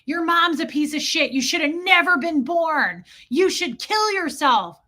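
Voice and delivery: high, pushy jeering voice; bull baiting insults. Retold or imitated. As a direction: sneer the insults at someone across the table. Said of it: "sitting across from someone going".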